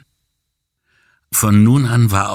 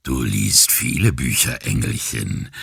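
low rasping sleazy flirt; a pushy ferry masher's rasp; gravelly pickup voice; 6 semitones lower than usual. Acted. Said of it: "mit der Reibeisenstimme all der zudringlichen Schwätzer". (Reibeisenstimme eines zudringlichen Schwätzers)

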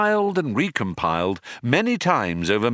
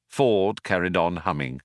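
ordinary delivery, nothing marked

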